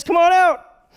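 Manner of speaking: high, loud comic holler; calling out. Acted. Jesus calls Peter out of the boat, high loud holler. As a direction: holler an invitation across the distance.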